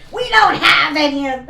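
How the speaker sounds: very loud, high cashier's yell; mock screamed refusal; imitated scream. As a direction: blast it out as the rude cashier. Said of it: "that's how loud she fucking screamed".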